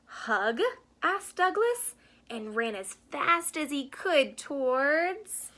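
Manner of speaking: gentle storybook read-aloud; reading to children. A narrator's reading. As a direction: read it like a bedtime picture book. Reads picture book to children.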